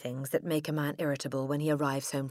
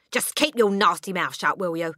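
snapped order, louder and higher; father snapping; 3 semitones higher, 6 dB louder than usual. A narrator's reading. Father snapping at his daughter, louder. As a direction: shut her up with a sharp snap. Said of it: "the father snapped".

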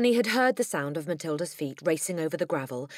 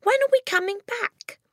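put-on very high, loud child's question; distressed cry; 5 dB louder than usual. A narrator's reading. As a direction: wail the question at Dad. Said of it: "Matilda cried".

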